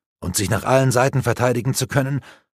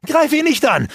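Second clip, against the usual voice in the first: much higher, louder pleading call; urgent shout; 12 semitones higher, 3 dB louder than usual. Acted. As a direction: shout to stop him attacking. Alana calling Granok off.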